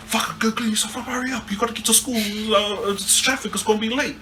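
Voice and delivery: high-pitched imitation of a hurrying parent; mock-scold the kids to hurry up; pissed-off dad. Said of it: "pissed off. He's, like, trying to get us to school".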